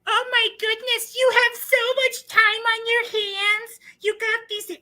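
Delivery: high, slow sarcastic falsetto; mocking falsetto. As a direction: tease them in a high voice. Mocking haters' effort.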